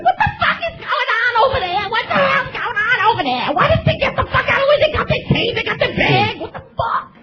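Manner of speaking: imitating angry wife. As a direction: rant like an impatient wife at a drive-thru. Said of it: "She's like".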